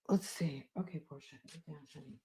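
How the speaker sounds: very soft, barely audible aside to a dog; murmured aside. Real, not acted. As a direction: talk quietly to the dog under your breath.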